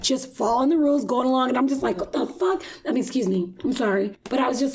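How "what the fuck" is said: much higher, incredulous "What the fuck?"; shocked outburst. Retold or imitated. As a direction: squeal it in disbelief. Shocked at rude attendant.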